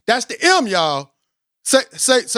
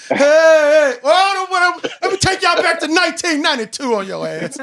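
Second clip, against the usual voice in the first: real excitement; 8 semitones higher than usual. Real, not acted. Host hyping nineteen ninety-two, higher excited burst. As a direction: get carried away with hype. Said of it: "let me calm down".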